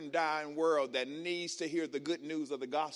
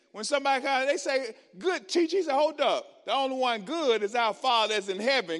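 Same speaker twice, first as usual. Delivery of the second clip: high, loud preacher's cry; full-force preaching; 7 semitones higher, 9 dB louder than usual. Acted. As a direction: preach it out, let loose.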